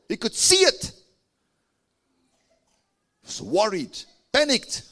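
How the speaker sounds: high, loud preaching climb; fiery preaching. Acted. Preacher builds to full force.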